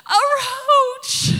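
loud, high sobbing wail; crying wail. Retold or imitated. Wife answering her husband through sobs, loud high wail. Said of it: "And I'm crying".

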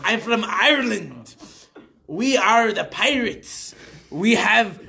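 hammed-up Irish accent with long pirate R; piratey Irish accent. Comic claiming the Irish are pirates, piratey Irish accent. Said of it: "You kinda like being Captain Arr".

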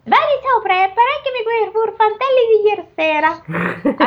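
high ogre voice. Acted. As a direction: redo it as the ogre. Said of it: "l'ho detta senza fare la voce".

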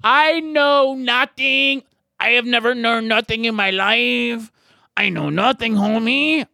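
slow, high goofy voice saying he knows nothing; put-on dumb voice. Host mocking himself to callers, lightly put-on clueless voice. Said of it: "I'm done talking like that".

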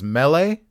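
"melee" with short e, then lay; MEL-lay, lifted at the end; alternative pronunciation. Shown as a demonstration. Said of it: "I've also heard it as".

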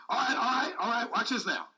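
ordinary delivery

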